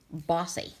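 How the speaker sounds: ordinary delivery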